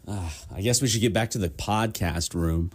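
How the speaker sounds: ordinary talk, nothing marked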